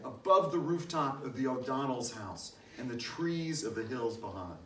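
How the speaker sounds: ordinary delivery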